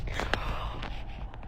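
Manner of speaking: sharp breathless gasp of fright; frightened gasp. Real, not acted. Gasping at a noise in the empty house. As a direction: gasp as if something moved upstairs.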